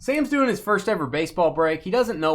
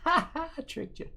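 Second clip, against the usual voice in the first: teasing laugh; 13 semitones higher, 6 dB quieter than usual. Real, not acted. Teasing after joke.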